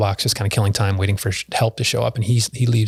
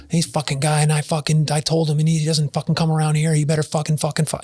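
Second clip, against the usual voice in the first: mock ranting neighbor, pitched up; imitating angry neighbor; 6 semitones higher than usual. Neighbor ranting at poor woman, mock angry. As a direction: rattle off the swearing like the angry guy. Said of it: "just take his".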